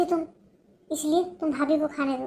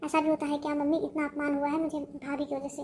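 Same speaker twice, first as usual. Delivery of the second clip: usual speaking voice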